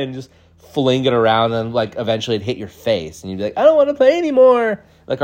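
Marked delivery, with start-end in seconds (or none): 3.6-4.8 high, quick whine; hammed-up tearful whine; mock crying child. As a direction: wail it like a kid hit by a ball. Are you crying.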